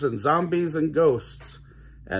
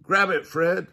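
high, mildly raised storybook shout; shouted command; 6 semitones higher, 3 dB louder than usual. A narrator's reading. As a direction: call it out high. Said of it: "Shaggy shouted".

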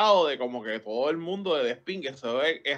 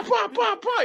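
punch sounds; slower than usual. Retold or imitated. Imitating punches, laughing.

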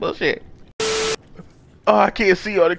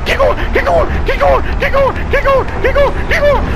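high-pitched, loud staccato panic screams; frantic screaming; 4 dB louder than usual. Real, not acted. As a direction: squeal the same order desperately, over and over. Desperate escape from pursuers.

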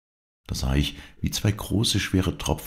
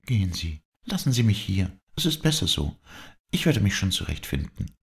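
put-on higher young woman, sad and pleading; a despairing girl pushing help away, pitched higher; higher, tearful young woman refusing comfort; Dorette's voice; 7 semitones higher than usual.

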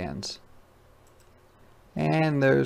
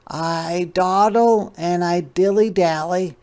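unhurried sloth; 7 semitones higher, 6 dB louder than usual. Acted. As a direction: talk like a calm sloth in no hurry, much higher. Sloth voiced in read story.

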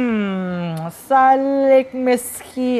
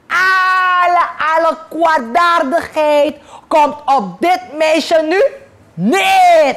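louder, chanted magic incantation; casting a spell; 4 semitones higher, 4 dB louder than usual. Acted. Sorceress casting a spell on the girl, chanting loudly. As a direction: intone the spell with gusto.

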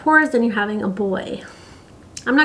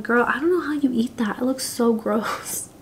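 mock disgusted hush; 5 dB quieter than usual. Retold reaction to cousin's sushi.

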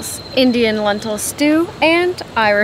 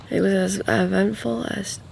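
whispering; 5 dB quieter than usual. Real, not acted. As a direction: whisper so as not to wake anyone. Morning, partner still asleep.

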